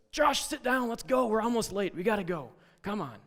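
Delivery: high, loud hurried driver voice; impatient bus driver. Retold or imitated. Bus driver rushing Josh to sit, high loud impatient. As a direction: hurry the kid into his seat, high and loud.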